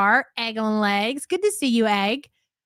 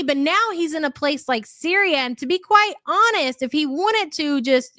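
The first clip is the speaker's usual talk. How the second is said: ordinary talk, nothing marked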